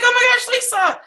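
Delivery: excited greeting. Retold or imitated. Friend spotting Lisa at the training, gushing excitedly. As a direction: gush over seeing Lisa. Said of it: "She's like".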